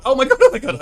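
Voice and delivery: very high, loud panicked shriek. Real, not acted. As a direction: shriek in alarm, rising each time. Sudden panic in game.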